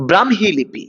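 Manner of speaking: "ব্রাহ্মী" as bram-hi, the h sounded after m; correct pronunciation. Shown as a demonstration. Teacher shows students how the word goes, correctly pronounced. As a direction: model "ব্রাহ্মী" clearly, bram then hi. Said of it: "এরকম বলবেন না".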